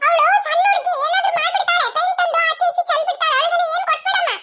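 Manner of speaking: put-on very high, insistent little-girl voice; high-pitched childish protest. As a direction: object frantically like a jealous sibling. Child protesting against sister getting paint.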